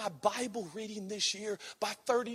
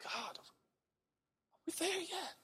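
soft plea; 10 semitones higher, 14 dB quieter than usual. Retold or imitated. Weary prayer to God.

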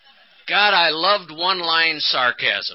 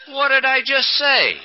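feigned innocence; 9 semitones higher, 3 dB louder than usual. Retold or imitated. Husband plays dumb.

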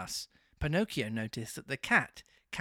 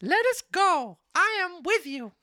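very high, loud puppet voice; marionette voice; 7 dB louder than usual. A narrator's reading. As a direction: pitch it way up as the naive little marionette. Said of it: "said to the fox and the cat".

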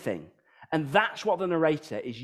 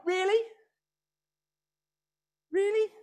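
incredulous. Real, not acted. Mocking the sad song lyrics.

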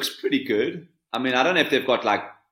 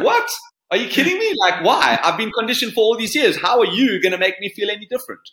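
incredulous; 7 semitones higher than usual. Retold or imitated. Voicing a doubtful older learner.

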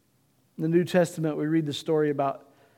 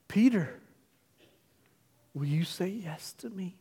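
tender loving voice; 4 dB quieter than usual. Acted. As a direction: speak to Peter like forgiving a broken friend. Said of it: "a look to Peter to say".

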